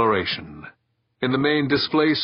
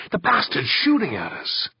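alarmed; 9 semitones higher than usual. Acted. Ship under attack, Victor alarmed.

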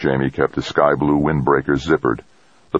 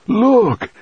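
high, loud sudden cry; urgent cry; 4 dB louder than usual. A narrator's reading. Grandfather crying out to his grandson, high and loud.

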